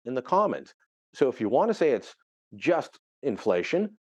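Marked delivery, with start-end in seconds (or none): 2.5-4.0 slow "just", small pause, then "inflation"; little pause. Shown as a demonstration. Commentator showing the allowed way: slow, with a small pause. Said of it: "you gotta put that little pause in there".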